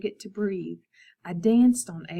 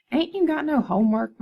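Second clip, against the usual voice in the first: higher, louder whiny brother; annoyed big brother groan; a mild groaning complaint; 6 semitones higher, 6 dB louder than usual. A narrator's reading. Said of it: "my brother groaned".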